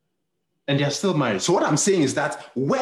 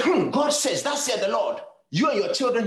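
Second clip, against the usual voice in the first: prophetess voice; 8 semitones higher than usual. Retold or imitated. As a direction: imitate a prophetess pronouncing a word from God.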